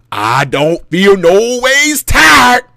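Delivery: very slow, loud drawn-out sermon line; mock church shout. Calling out to church people, slow and loud. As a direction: belt it like preaching to church. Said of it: "Guess what, church people?".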